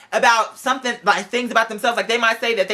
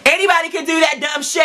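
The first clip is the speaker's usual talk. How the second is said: normal talking voice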